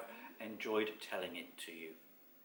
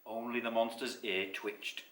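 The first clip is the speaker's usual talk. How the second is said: plain reading voice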